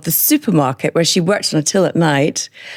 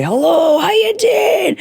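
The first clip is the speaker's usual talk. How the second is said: hammed-up Scottish greeting, much higher; Billy Connolly impression; slower than usual. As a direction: shout it like a broad Scottish comic. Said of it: "like, Billy Connolly".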